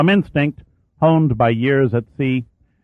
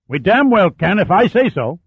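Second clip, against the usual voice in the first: loud, much higher angry retort; angry captain; 9 semitones higher, 4 dB louder than usual. Acted. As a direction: slam the order down. Captain shouts down his officer.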